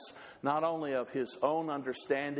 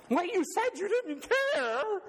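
husband's voice. Acted. Husband reminding wife, mock defensive and high. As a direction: point out what she said.

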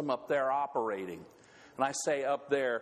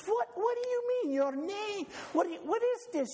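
very high, incredulous put-on voice; Lena's voice. Lena questioning Ole, very high and incredulous. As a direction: squeak out the disbelief. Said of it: "And she says".

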